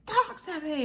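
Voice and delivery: high, insistent whine in imitation; pestering mimicry. Clingy partner following someone around, mock pleading. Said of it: "the whole following someone around".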